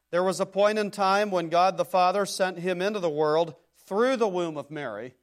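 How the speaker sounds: loud, driving pulpit delivery; forceful preaching. Real, not acted. Preacher telling of God sending his Son, forceful preaching. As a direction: drive it out loudly to the congregation.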